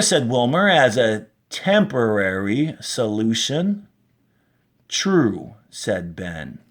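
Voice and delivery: slow, expressive read-aloud for the class; story reading. A narrator's reading. Teacher reads a science fiction excerpt to her class, expressive storytelling.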